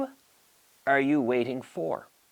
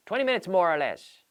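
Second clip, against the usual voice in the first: Spanish-style phrasing; 7 semitones higher, 4 dB louder than usual. Shown as a demonstration. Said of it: "It's very Spanish".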